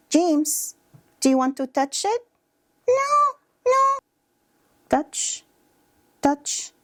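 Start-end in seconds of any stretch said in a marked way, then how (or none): 2.9-4.0 put-on very high-pitched child refusing; high, small kid's voice saying no; squeaky little-kid refusal. Child answering therapist in role-play.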